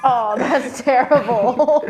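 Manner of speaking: loud, high, drawn-out words through laughter; real laughing protest. Real, not acted. Guest told to do a segment win or lose, laughing protest. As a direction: protest loudly while cracking up.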